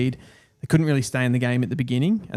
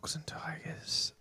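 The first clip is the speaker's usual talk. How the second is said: whisper; 4 semitones higher, 15 dB quieter, slower than usual. Real, not acted. Whispered echo of the options.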